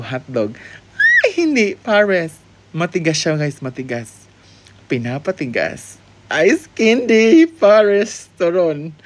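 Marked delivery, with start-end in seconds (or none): 6.3-9.0 loud excited guessing. Real, not acted.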